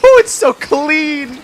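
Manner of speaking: high, loud, slow delight; excited gush about the new wallpaper. Retold or imitated. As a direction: swoon over how good it looks. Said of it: "and it's like".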